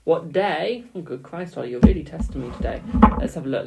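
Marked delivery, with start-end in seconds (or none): none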